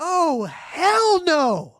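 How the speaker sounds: very high, loud, drawn-out hell no; hammed-up refusal. Margaret refusing to let Kevin stay over, shrieking mock refusal. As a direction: wail the refusal over the top.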